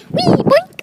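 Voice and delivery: loud high-pitched wee and boink; playful noise. Pretend. Playing about on the walk, high playful noises. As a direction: squeal it like a cartoon bounce.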